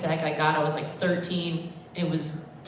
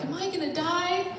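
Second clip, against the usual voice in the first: put-on small kid voice; 10 semitones higher, 3 dB quieter than usual. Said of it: "a kid was like".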